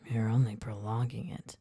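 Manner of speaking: low whispered chuckle; deep chuckle. A narrator's reading. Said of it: "with a deep chuckle".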